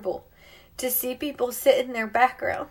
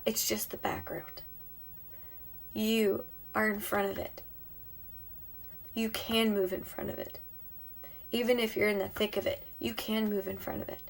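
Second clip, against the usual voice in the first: softly reassuring; 7 dB quieter than usual. Real, not acted. Soft reassurance to viewers.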